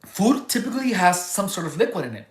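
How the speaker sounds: normal talking voice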